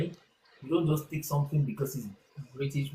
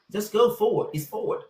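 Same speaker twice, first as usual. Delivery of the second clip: quoting a video; 7 semitones higher, 5 dB louder than usual. Retold or imitated. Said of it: "The guy said".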